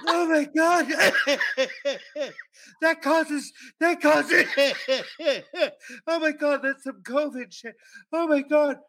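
high, laughing while it burns; laughing through pain. Real, not acted. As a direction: laugh off the burning taste.

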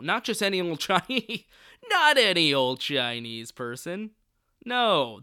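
loud, drawn-out mock insistence; hammed-up scornful emphasis; mock indignant refusal. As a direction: imitate an outraged gatekeeper, hammering it out. Acting out board's scornful refusal.

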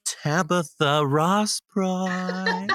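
drawn-out, showy name announcement; host introducing guest, drawn out; grand slow welcome. Real, not acted. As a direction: announce her like royalty, slowly.

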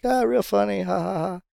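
dry fake laugh at a supposed prank; sarcastic. Retold retort to suspected prank.

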